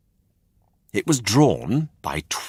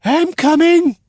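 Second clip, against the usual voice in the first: very high, loud and sing-song; a little creature calling out brightly; high snail voice; 9 dB louder than usual. A narrator's reading. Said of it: "said the snail".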